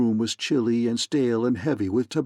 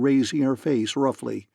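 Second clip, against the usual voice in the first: plain reading voice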